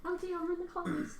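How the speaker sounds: high practice line in mock British; British accent. Shown as a demonstration. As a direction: rehearse a hammy British accent. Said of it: "how do you get in your British accent?".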